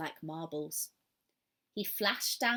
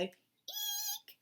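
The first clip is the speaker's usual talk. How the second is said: barn owl screech demo; 6 dB quieter than usual. Shown as a demonstration. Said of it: "like that".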